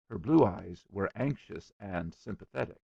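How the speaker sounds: ordinary delivery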